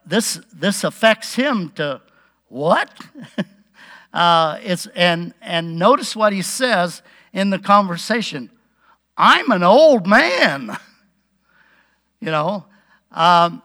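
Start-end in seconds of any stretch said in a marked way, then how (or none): 2.5-2.9 a quick rising, incredulous what; mock-startled reply; startled disbelief. Zechariah's imagined startled reaction. 9.2-10.8 slow, drawn-out mock protest; playfully imitating a doubter's complaint; protesting old man. Acting out Zechariah's doubting reply.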